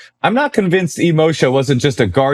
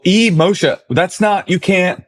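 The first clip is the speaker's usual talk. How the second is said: retold indignation; 8 semitones higher, slower than usual. Retells outrage at copied name.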